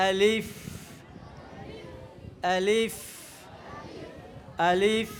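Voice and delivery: low, even আলিফ ending in a long f hiss; correct আলিফ. Shown as a demonstration. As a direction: say আলিফ ending in a true labiodental f. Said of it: "উচ্চারণের শুদ্ধ নিয়ম কি".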